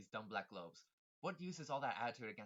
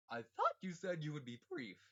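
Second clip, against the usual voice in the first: usual speaking voice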